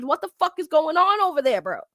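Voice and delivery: ordinary delivery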